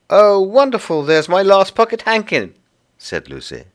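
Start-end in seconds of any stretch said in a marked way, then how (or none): 0.1-2.5 high, loud burst of delight; delighted child. Acted. Lucy finds her last hankin, high and delighted. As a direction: exclaim joyfully as a little girl.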